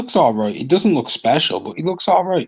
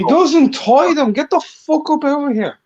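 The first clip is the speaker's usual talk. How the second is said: high-pitched, scornful dismissal; real exasperated disbelief; indignant objection; 10 semitones higher than usual. Real, not acted. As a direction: shoot the claim down, pitch jumping up. Heated objection to a record claim.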